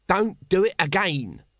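high, slow warning; admonishing. A narrator's reading. Small corporal warns felons before running off, admonishing. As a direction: spell out the warning in a scolding voice. Nobby hastily cautions waking felons.

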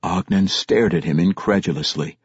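usual speaking voice

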